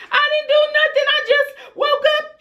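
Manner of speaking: put-on high and fast, a kid insisting innocence; high rushed childish denial; whiny child denial. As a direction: squeak out a quick fib like a guilty child. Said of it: "She was like".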